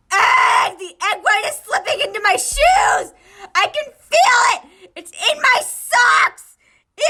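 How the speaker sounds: loud high panicked shrieks; panicked screaming. Acted. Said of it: "Okawa was panicking".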